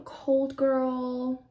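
ordinary talk, nothing marked

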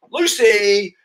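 big high announcement of the name; winner reveal. Acted. Host naming the prize winner, high and excited. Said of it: "drum roll please".

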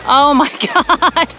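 drawn-out oh my God through a laugh; laughing disbelief. Real, not acted. Mom watching the dog swim off, laughing in disbelief.

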